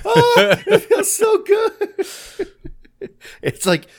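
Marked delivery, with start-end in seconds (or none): 0.0-2.5 very high, loud, drawn-out relief; high-pitched exaggerated cry of bliss; relieved moan. Retelling a movie peeing scene to friends, mock ecstatic relief.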